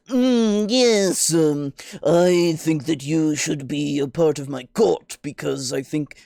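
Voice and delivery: high opening, slow lordly drawl; haughty impression. Player contrasts haughty courtier inviting someone to court, slow and haughty. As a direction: invite them loftily, dragging out each phrase. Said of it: "an air of, um, of haughtiness".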